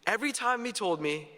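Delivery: ordinary talk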